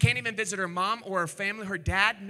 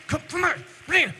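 higher and louder, shooing someone off; hammed-up shove-off, higher and loud; mock angry shooing; 7 semitones higher, 6 dB louder than usual. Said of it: "They're out like".

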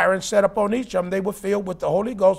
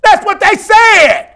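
high, drawn-out full-force shout; emphatic preaching shout; 10 semitones higher, 10 dB louder, slower than usual. Real, not acted. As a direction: hammer the point home, loud and slow. Preacher drives the point home.

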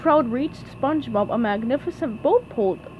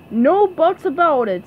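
firm, louder, raised refusal; stubborn; 5 semitones higher, 6 dB louder than usual. A narrator's reading. Patrick refusing SpongeBob's plan, stubbornly. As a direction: put your foot down. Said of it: "said Patrick stubbornly".

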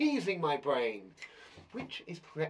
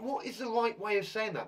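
ordinary talk

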